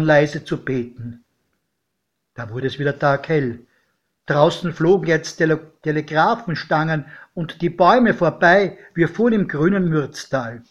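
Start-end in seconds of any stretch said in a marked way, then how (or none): none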